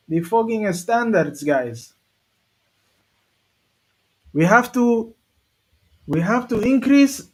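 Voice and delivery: hammed-up Spanish-accented coach's plea; terrible Mikel Arteta. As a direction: put on a clumsy Spanish accent, stretching the words slowly. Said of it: "That's a terrible Mikel Arteta".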